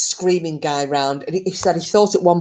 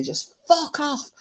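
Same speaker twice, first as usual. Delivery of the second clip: slow, high mock yell at the dark; retold shout; 10 semitones higher, 4 dB quieter than usual. Man yelling into the woods at the unseen creature, mock shout. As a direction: drag the words out high as a half-hearted shout. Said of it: "he shouted at this thing".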